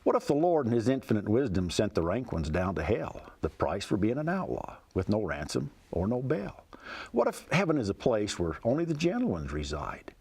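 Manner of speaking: poem recited with a folksy lilt; cowboy poetry. Acted. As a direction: tell it like a rhyming cowboy yarn. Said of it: "It goes like this".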